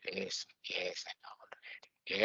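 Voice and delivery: whispered, smug yes yes; a smug ego whispering it already knows; dismissive whisper. Retold or imitated. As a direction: brush it off under your breath like a know-it-all ego. Ego brushing off advice.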